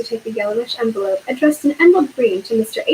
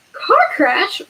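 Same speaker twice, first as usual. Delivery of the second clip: roared; 9 semitones higher than usual. A narrator's reading. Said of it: "roared Hagrid".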